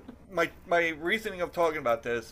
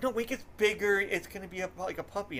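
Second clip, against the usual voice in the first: wife's voice, much higher and insistent; a hint of a defensive wife, raised high; imitating wife; 8 semitones higher, faster than usual. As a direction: pitch up high to play the wife. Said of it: "So then it's like".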